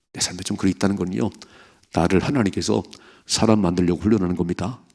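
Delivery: normal talking voice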